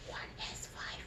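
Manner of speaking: soft breathy whisper right in the ear. Shown as a demonstration. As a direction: whisper it close, voiceless. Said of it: "I'm going to whisper in your right ear".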